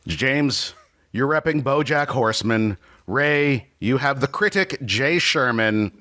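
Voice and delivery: ordinary delivery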